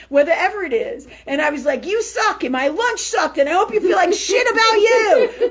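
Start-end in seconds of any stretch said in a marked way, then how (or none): 1.9-5.5 high, loud re-enacted rant; mock raging. Re-enacting her raging moment at her sister, mock furious. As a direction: act out an angry tirade, pitched up. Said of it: "yesterday I had a raging moment".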